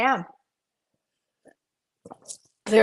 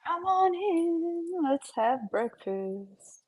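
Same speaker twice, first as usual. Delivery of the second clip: sing-song greeting; 6 semitones higher, 7 dB quieter, much slower than usual. Acted.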